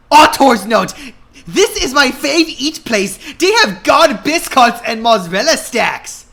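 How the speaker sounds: high, loud giddy gushing; hammed-up excited fangirl; gushing aside. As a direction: gush about your favourite restaurant.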